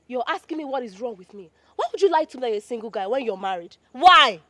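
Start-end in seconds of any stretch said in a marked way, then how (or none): 3.9-4.4 angry why. Acted. Woman confronts lying man.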